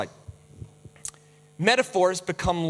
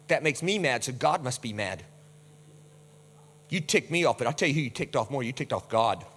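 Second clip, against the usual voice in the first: lower, softer smug warning; mock self-righteous threat; 6 semitones lower, 4 dB quieter than usual. Believer invoking God's anger, low smug warning. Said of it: "we do that with wrath".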